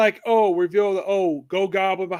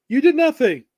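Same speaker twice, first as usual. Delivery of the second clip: pointed retort; 6 semitones higher, 5 dB louder than usual. Retold or imitated. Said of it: "and someone points out".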